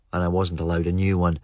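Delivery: usual speaking voice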